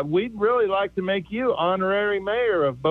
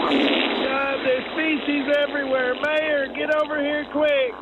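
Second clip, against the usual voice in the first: higher put-on wife voice, urgent; mock panicked wife; 6 semitones higher than usual. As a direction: call the mayor in a panic. Wife panicking over the toilet.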